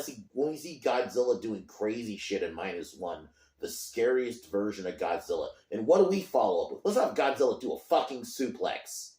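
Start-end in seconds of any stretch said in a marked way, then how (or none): 5.7-9.1 loud, high-pitched mocking outrage; exasperated sarcasm. Real, not acted. Fan mocking the studio's follow-up, exasperated sarcasm. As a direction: pitch the idea in sarcastic disbelief.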